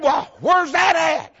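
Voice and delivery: high, loud, slow question; mock-eager husband, loud and slow. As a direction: shout it out, drawn out. Husband asks where the store is.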